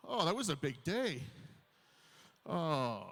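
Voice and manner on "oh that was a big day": ordinary delivery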